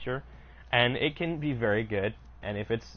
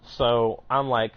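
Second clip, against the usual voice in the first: normal talking voice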